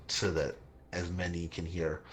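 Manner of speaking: ordinary talk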